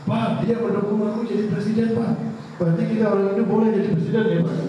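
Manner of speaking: high and fast, eager; excited child. Acted. Boy excitedly asks his father about becoming president. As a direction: rush it out, thrilled.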